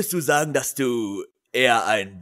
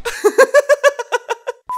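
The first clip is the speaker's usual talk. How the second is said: lautes Lachen; 8 dB louder than usual. Real, not acted. Lautes Lachen nach falscher Antwort.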